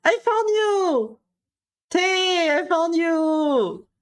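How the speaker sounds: high, drawn-out happy shout; excited shout. Real, not acted. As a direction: call out in joy at finding a name. Spotting a viewer's name.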